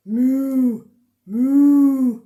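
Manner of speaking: loud long mooing; cow noise. Pretend. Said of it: "making the animal noises".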